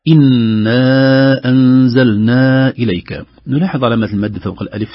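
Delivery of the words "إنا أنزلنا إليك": slow, loud chanted recitation; Quran recitation. Shown as a demonstration. Teacher reciting a verse for students in chanted style. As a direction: intone it like tajweed reading.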